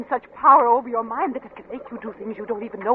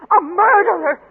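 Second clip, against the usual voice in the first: loud, high accusation; wife denouncing husband, loud and raw; accusing cry; 8 semitones higher, 7 dB louder than usual. Acted. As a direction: hurl the word at him.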